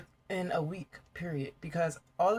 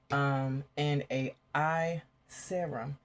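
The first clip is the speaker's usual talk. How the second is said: ordinary delivery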